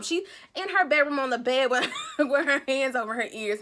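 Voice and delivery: amused laughing narration. Real, not acted. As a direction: talk through laughter. Laughing while telling.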